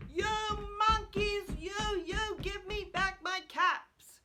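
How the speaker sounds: high, loud storybook shouting; angry shout. A narrator's reading.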